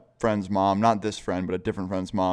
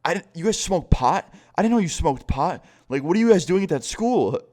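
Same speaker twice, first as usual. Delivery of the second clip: higher, stunned and disbelieving mom voice; shocked mom; 7 semitones higher, 3 dB louder than usual. Retold or imitated. Mom finding her son's friends smoking pot, shocked and higher. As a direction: act out a mom who just caught the kids smoking. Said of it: "she starts freaking out".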